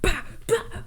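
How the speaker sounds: high punchy bah sounds; imitating Peeta's stabbing with punchy high bursts; stabbing noises. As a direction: jab the sounds out. Imitating machete stabs.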